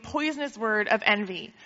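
ordinary delivery

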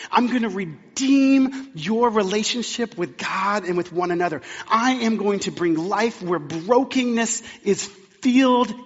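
loud, high, slow climactic preaching; full-force preaching. Real, not acted. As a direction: build the promise to a fervent peak.

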